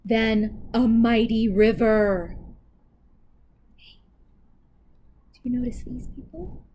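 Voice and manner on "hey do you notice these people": hushed aside to the listener; quiet aside. Real, not acted. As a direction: drop to a hush, confiding. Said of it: "aside to listener".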